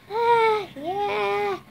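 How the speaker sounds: high squeaky slime voice; slime character voice. Pretend. Kid voicing her slime toy, squeaky high voice.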